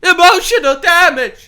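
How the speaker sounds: high, loud meme voice yelling emotional damage; meme shout. Pretend. Streamer shouts the meme at the clip, hammed-up. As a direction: belt out the meme line.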